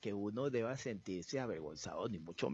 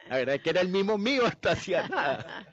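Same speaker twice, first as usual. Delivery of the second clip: laughing, louder and fast; 7 semitones higher, 8 dB louder, faster than usual. Real, not acted. To the interviewer, laughing at his own accent. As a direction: laughs while admitting it.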